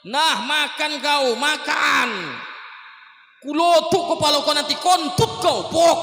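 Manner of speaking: high, loud furious yelling while force-feeding; hammed-up angry shove of food, shouting. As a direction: bark the food at him in a rage. Angry Abu Bakar force-feeding blind man.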